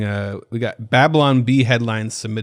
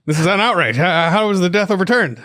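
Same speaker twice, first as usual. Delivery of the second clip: higher, louder indignant caller; mock outrage; 8 semitones higher, 5 dB louder than usual. Reporter phoning the court clerk, mock outraged. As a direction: play a hammed-up outraged reporter.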